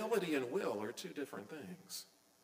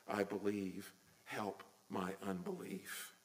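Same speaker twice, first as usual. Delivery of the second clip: humble plea; 9 semitones lower, 8 dB quieter than usual. Retold or imitated. Father's humble plea to Jesus.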